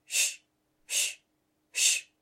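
quiet rustling hiss; soft shushing. A narrator's reading. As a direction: breathe it like grass rustling. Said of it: "très doucement".

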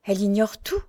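faint, fading voice, lightly done; dying voice. A narrator's reading. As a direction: say it as if strength is slipping away. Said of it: "d'une voix mourante". (d'une voix mourante, légèrement jouée)

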